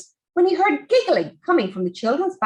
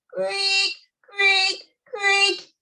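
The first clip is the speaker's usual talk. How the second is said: creak sound; 7 semitones higher, much slower than usual. A narrator's reading.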